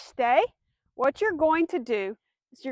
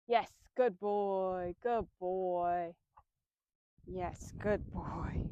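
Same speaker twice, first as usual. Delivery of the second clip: softer, lower, slow praise for the dog; 6 semitones lower, 9 dB quieter, much slower than usual. Real, not acted. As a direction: coo it gently to the dog.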